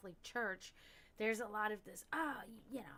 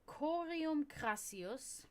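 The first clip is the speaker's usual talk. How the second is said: reading Latin very slowly; slow careful reading; 4 semitones higher, much slower than usual. Shown as a demonstration. Teaching viewers to read the Latin phrase slowly. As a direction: read each Latin word deliberately. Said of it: "read the whole thing".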